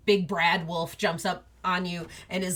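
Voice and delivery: normal talking voice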